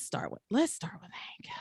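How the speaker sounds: hushed whisper, no voice. Real, not acted. Podcaster whispering to co-host, whispered. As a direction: drop to a breathy aside.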